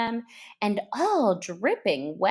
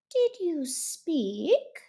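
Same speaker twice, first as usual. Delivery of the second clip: a hint of stiff politeness; 6 semitones higher, much slower than usual. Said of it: "frowning but very politely".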